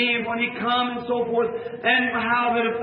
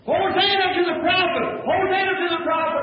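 crowd screaming; 7 semitones higher than usual. Retold or imitated. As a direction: shout it like a cheering crowd. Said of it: "thousand people screaming".

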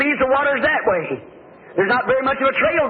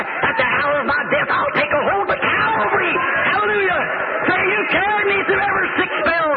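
high, ringing preacher's exclamation; exultant preaching; 6 semitones higher than usual. Real, not acted. Preacher to congregation on trusting the Lord, exultant preaching. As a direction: preach it up high, building to hallelujah.

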